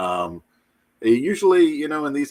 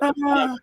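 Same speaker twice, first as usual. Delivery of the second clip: burst of laughter. Real, not acted. Reacting to lost notes.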